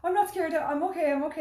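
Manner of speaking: high, loud, unconvincing reassurance; panicked denial. Retold or imitated. Scared mother reassuring her children, panicked denial. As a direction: insist on it in re-enacted panic.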